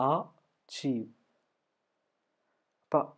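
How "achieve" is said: Achieve split as ah... chee; syllable by syllable. Shown as a demonstration. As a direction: pause after the first sound of "Achieve". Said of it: "അച്ചീവിൻ്റെ അകത്ത് എ വരുന്നുണ്ട്".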